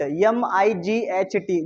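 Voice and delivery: slow letter-by-letter spelling. Shown as a demonstration. Teacher spells a word for the class, slowly letter by letter.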